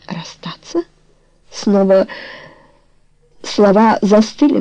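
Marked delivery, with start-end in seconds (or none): 0.1-0.8 a breathless whisper, voice gone; stunned whispered question to her lover; shocked whisper. A narrator's reading. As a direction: barely breathe the word in disbelief. Stunned question after breakup news.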